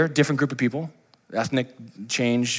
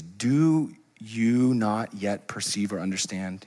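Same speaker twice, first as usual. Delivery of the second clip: drawn out very slowly; slow probing question; 3 semitones lower, 5 dB quieter, much slower than usual. Retold or imitated. Jesus questioning his disciples, slow and deliberate. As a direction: let each word land slowly. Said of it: "He says".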